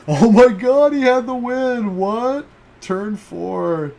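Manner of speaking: high, loud shocked shriek with a laugh. Real, not acted. Streamer shrieking at a shock finish. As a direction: gasp it out in disbelief, way up high.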